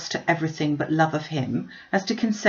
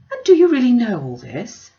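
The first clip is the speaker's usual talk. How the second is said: higher and louder, curious and astonished; eager surprise; 6 semitones higher, 7 dB louder than usual. A narrator's reading. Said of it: "cried Missus Gardiner, whose curiosity".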